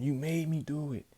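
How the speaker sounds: high, pleading excuse; mock guilty excuse. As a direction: blame him while apologizing. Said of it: "he tells him".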